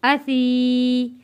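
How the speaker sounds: असी with the ई drawn out one alif long; slow recitation, long final ई in असी; stretched Quranic reading. Shown as a demonstration. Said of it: "एक अलिफ के बराबर खींच कर पढ़ते हैं".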